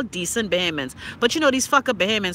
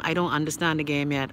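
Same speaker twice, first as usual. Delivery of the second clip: ordinary delivery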